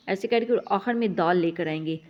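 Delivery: ordinary delivery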